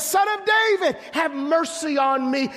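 a blind man shouting to Jesus from the roadside; beggar crying out. Acted. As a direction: cry out across a crowd for help.

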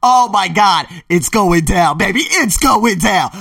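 high, loud whoop; hyped shout over his plans; loud excited shout. Real, not acted. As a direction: yell it out, hyped. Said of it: "trying not to be so loud".

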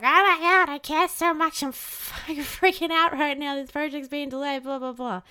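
loud, high mock freak-out that fades by the end; panicked outburst. The nervous system panicking over a delayed project, loud and high mock panic. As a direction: burst out in a panic, then trail off.

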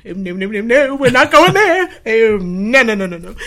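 high loud panicked protest. Retold or imitated. Refusing God's call, panicking and shouting no. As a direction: back away in alarm, half laughing.

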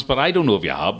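ordinary talk, nothing marked

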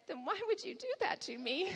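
put-on high-pitched wronged little boy; young son. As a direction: imitate a child's puzzled reproach to mom. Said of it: "And he said".

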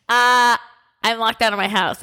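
ordinary delivery

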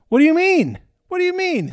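high, loud disbelieving cry; older brother's high indignant protest; indignant outburst. Retold or imitated. Older brother becomes angry, line 90.

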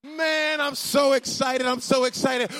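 real excitement, lifting to a shout; fired up. Real, not acted. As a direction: preach it with real excitement over music. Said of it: "I am so fired up about this".

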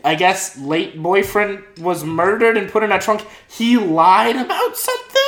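high, hammed-up incredulity; mock disbelief. Listener hears the upstanding man lied, mock disbelief. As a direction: feign outrage, pitching up into the questions.

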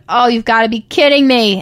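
loud, exasperated retold protest; mock disbelief. Patient hearing how long stitches will take, incredulous. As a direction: play up being appalled at the news.

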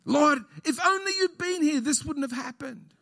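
high, pleading lament to the Lord; mock lament; Mary's complaint.

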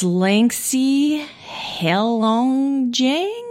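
"Heilongjiang" stretched out, unsure of the sounds; tentative pronunciation. Real, not acted. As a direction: sound out "Langsi Heilongjiang" slowly with a questioning rise. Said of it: "I'm gonna try to say it".

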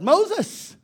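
loud, high mock outcry; hammed-up complaining shout; mock crying out. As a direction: cry out in protest, building to a yell on Moses. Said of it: "they're really telling God off".

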